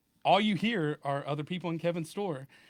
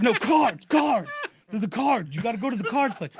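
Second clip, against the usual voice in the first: impatient, higher and louder correcting him; insistent scammer; 5 semitones higher, 5 dB louder than usual. Retold or imitated. Scammer correcting the confused victim, impatient and raised. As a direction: scammer pushing for the cards, a bit exasperated.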